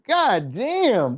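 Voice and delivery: ordinary talk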